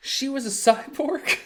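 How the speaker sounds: surprised. Real, not acted. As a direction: blurt it out in disbelief. Reacting to a chat claim.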